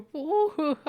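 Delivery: very high, loud gnome whoop with laugh; high mock gnome "whoa"; imitating the gnome sound. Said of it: "Nah, it's just not happening".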